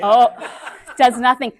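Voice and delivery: laughing through the words; real laugh over the joke; amused at the audience's dentist joke. Real, not acted.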